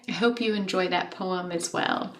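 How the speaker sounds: ordinary delivery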